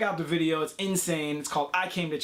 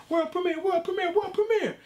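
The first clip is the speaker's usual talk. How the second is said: high rising hype man shout; hype call; 13 semitones higher than usual. Acted. Rapper hyping his premiere, high excited calls. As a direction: hype it like an announcer.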